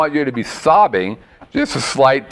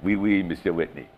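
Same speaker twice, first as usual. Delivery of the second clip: lightly put-on French accent, low and soft; a sleepy, lightly done French accent for the monkey; 8 semitones lower, 9 dB quieter than usual. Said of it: "It's a French monkey".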